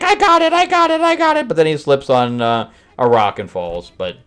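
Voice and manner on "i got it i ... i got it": very high, loud repeated cry; gleeful cartoon yelling on the run; mock excitement. Voicing the gorilla running off with the warp drive, mock excitement.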